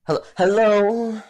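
loud, high mock customer hello; impatient customer. Customer calling into the drive-thru speaker, loud mock hello. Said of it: "they're gonna say".

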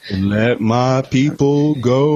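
slow, mock solemn prophet proclaiming; hammed-up slow biblical line. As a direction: intone it like Moses, slowly. Biblical joke about Florida.

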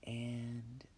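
breathy whisper, calm and unhurried; guided meditation. Acted. Leads a relaxation meditation.

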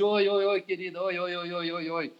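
soft, fading mock sugary greeting to fans; mocking fake greeting. [name] mocks announcers greeting girls, fading sugary hellos. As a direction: mimic a vain announcer's put-on hello. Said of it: "fazendo aquela pose".

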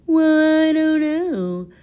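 high silly puzzled voice; clueless kid voice. Pretend. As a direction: play dumb, high-pitched. Pretend clueless kid answering.

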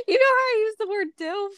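high-pitched, laughing rush; barely holding back laughter. Real, not acted. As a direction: squeak it out fast through giggles. Laughing while explaining risqué word.

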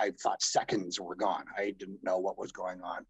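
usual speaking voice

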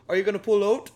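playful Canadian impression, punched up; mock Canadian accent. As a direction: put on a broad Canadian accent. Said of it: "as soon as you say Canadian".

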